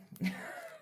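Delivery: high, breathless whimpering laugh; a shaky laugh that half turns to a cry; nervous cry-laugh. Real, not acted. Nervous cry-laugh about going up on the rope. Nervous reaction before the winch lift.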